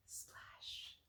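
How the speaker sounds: breathy whispered sound word; hushed splash as he hits the water. A narrator's reading. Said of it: "whispered sound effect".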